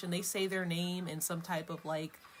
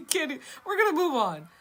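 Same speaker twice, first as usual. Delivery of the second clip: laughing it off, pitch up and louder; giggling and moving on; 10 semitones higher, 8 dB louder than usual. Real, not acted. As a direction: brush it off through a laugh. Laughing at forgetting a name.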